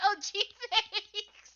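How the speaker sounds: drawn out very slowly through laughter; laughing mock thanks. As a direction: stretch it out while cracking up. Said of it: "I was like".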